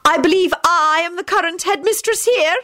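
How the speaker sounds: high, strident assertion of rank. A narrator's reading. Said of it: "said stridently".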